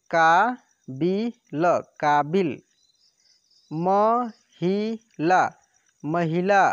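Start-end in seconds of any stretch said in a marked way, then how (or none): 0.1-1.3 काबिल stretched very slowly; slow drawn-out word. Shown as a demonstration. Teacher modelling काबिल slowly for pupils. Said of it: "ऐसे पढ़ेंगे". 3.7-5.4 महिला stretched very slowly and louder; slow drawn-out word. Shown as a demonstration. Teacher modelling महिला slowly for pupils. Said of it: "ऐसे पढ़ेंगे".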